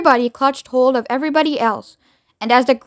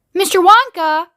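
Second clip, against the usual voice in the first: high, loud call; yell above noise; 7 semitones higher, 5 dB louder than usual. A narrator's reading. Charlie getting Mister Wonka's attention, yelling above noise. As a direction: call out over the roar. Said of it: "he yelled above the noise".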